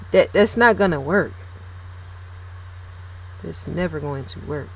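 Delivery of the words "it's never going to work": much softer, low-key delivery; quiet, almost under the breath; talking soft. Shown as a demonstration. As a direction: drop the volume right down and say it gently. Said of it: "Talking, talking soft".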